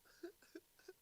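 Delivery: breathless unvoiced wheeze of laughter; silent wheezing laugh. Real, not acted. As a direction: laugh with no voice, just air.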